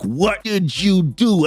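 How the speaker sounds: slow, drawn-out angry demand; pissed-off retelling. Retold or imitated. Peter confronts Craven after the shot, pissed off. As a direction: act out a slow-burning anger. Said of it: "dude is pissed".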